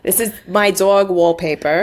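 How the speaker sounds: Jersey accent, questioning lift at the end. Shown as a demonstration. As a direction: show the line in a Jersey accent. Demonstrates the Jersey grandmother line.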